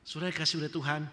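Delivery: ordinary talk, nothing marked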